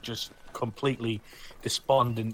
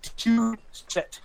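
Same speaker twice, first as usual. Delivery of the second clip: losing it; 11 semitones higher, 4 dB louder, slower than usual. Acted. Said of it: "loses it and is literally dragging".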